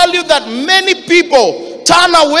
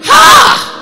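scornful ha; 13 semitones higher, 6 dB louder than usual. Real, not acted. Preacher's scornful exclamation.

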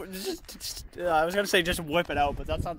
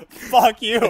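loud laughing; 5 semitones higher, 10 dB louder than usual. Real, not acted. Laughing outburst.

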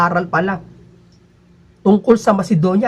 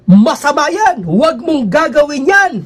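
higher, louder, slow preaching cadence; minister's sing-song preaching; 5 semitones higher, 3 dB louder than usual. Retold or imitated. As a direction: proclaim it like a minister from the pulpit. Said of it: "Ganun ang pagkasabi". (mataas at malakas na tono ng pangangaral)

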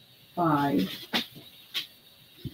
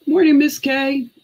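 cheery greeting; 8 semitones higher, 5 dB louder than usual. Real, not acted. Greeting viewer joining live.

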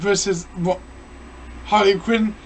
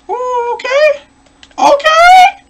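very high, louder squeal; squeaky high okay; 8 dB louder, slower than usual. Real, not acted. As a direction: squeal with surprise. Won round, squeaky exclamation.

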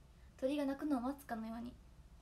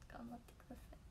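much softer, a playful whisper; 14 dB quieter than usual. Pretend. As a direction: whisper it as an ironic joke. Whispers right after urging loud voices.

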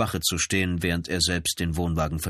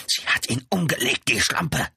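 high, agitated character voice; angry accusation; 7 semitones higher, 3 dB louder than usual. A narrator's reading. Brother accuses the woman to police, angrily. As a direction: spit out the accusation, pitched up.

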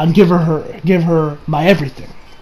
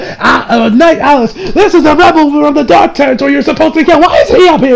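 high, agitated official's voice; mock-outraged cartoon official; flustered villain demanding; 8 semitones higher than usual. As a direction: act out a fussy, indignant accuser. Acted quote, villain demanding answers.

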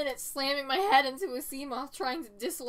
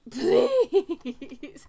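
laughing plea; 3 semitones higher, 9 dB louder than usual. Real, not acted. Begging stuck ship.

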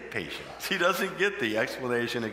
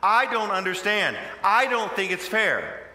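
loud, higher, slow protest at God; frustrated complaint; 8 semitones higher, 8 dB louder, slower than usual. Pretend. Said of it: "tempted to say".

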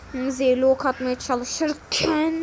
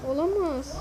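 ordinary talk, nothing marked

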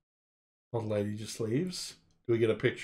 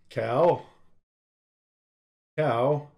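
loud questioning call; mock ox calling out; 2 semitones higher, 4 dB louder than usual. Ox calling out for the cow, loud. Ostrich calls out about cow.

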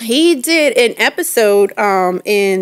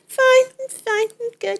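put-on teen son brushing off; 14 semitones higher than usual. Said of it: "he's like".

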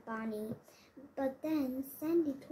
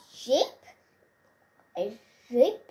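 can't believe what he just said; wife repeats the word in loud disbelief; incredulous cry; 7 dB louder than usual. A narrator's reading. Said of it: "cried Bonnie".

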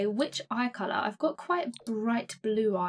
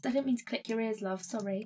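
ordinary talk, nothing marked

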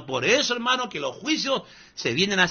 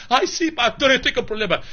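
higher, mocking voice of a naive believer; mock gullible believer; 7 semitones higher, 3 dB louder than usual. Preacher mocking a believer answering a false pastor, higher voice. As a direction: play the dupe, a touch higher.

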